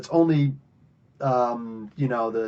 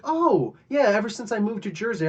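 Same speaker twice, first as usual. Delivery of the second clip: chirpy quote; 6 semitones higher than usual. Retold or imitated. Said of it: "And she's like".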